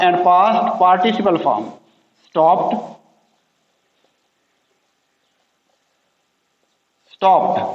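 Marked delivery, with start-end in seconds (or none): 2.2-7.6 stopped said with a final t sound, stopt. Shown as a demonstration. Teacher demonstrating the t ending of stopped for learners. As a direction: end stopped on a crisp t, not ed. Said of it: "मैं लिखता हूँ स्टॉप".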